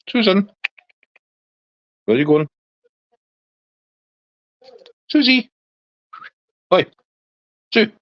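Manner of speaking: high, sing-song call to a wandering dog. Real, not acted. Calls out to his dog.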